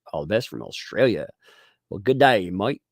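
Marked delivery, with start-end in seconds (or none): 1.9-2.8 put-on a louder, clumsy stab at an Australian accent, "mate" said as "mite"; bad Australian accent. Streamer thanks an Australian viewer, in a botched Australian accent. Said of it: "I can't do a freaking Australia".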